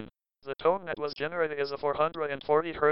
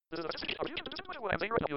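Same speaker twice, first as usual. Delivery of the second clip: rapid, quieter, higher; very fast; 5 semitones higher, 8 dB quieter, faster than usual. Shown as a demonstration. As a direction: rattle it off quickly. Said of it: "Or it can talk very fast".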